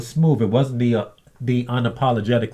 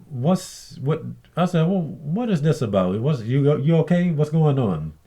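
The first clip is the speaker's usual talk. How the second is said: innocent questioning; 4 semitones higher than usual. Retold or imitated. Innocent questioning of angry coworker.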